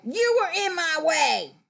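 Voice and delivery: high mock-rude accusation; rude complaint. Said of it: "Should you say ... No".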